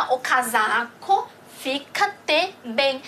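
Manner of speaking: slow, spaced out word by word, "fica-te" drawn out; slow model reading. Shown as a demonstration. Teacher models a Portuguese example for learners, slow and segmented. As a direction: read "o casaco fica-te bem" slowly in separate pieces.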